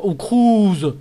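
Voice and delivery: loud, high call falling away; excited exclamation. Real, not acted. Streamer greeting a new card, excited cry. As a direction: cry out the name on a falling swoop. Reacting to a player pulled.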